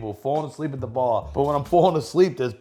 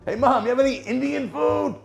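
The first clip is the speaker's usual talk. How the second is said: much higher, put-on calling voice; mock yell to mom; 11 semitones higher than usual. Son yelling to his mom for takeout, mock high call. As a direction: call out to mom in a silly strained voice. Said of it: "What is he supposed to scream".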